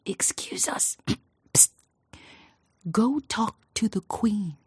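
hushed, conspiratorial aside, slow on the last words; secret whisper. Acted. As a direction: lean in and tip him off under your breath. Courtier secretly tipping off farmer.

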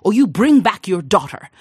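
plain reading voice

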